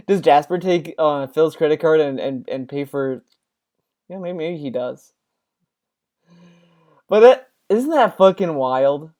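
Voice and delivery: ordinary talk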